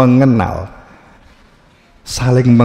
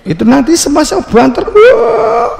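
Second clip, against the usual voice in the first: much higher, louder sudden shout; mock loud wail; 6 dB louder than usual. Teacher acts out the crying getting louder, with a much higher, louder shout. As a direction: burst out loud as the crying grows, way up high.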